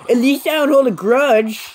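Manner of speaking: high, bratty imitation of a classmate; smug mock retort. Mimicking the classmate's smug claim, high and bratty. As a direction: sing it up high like a smug brat.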